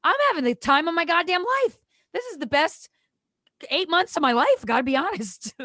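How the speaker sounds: high, loud, exuberant insistence; defiant retort. Retold or imitated. Daughter rebuffing worried mother, high loud defiance. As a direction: shout it up high, breaking into a laugh at the end.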